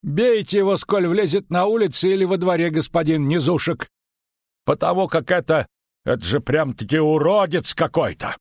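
high-pitched tavern keeper; agitated innkeeper. A narrator's reading. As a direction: protest in a high, animated voice. Innkeeper's figure in story.

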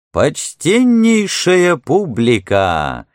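high, slow showman's announcement; booming circus ringmaster. Acted. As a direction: call out like a fairground barker. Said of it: "заводил Хардинг".

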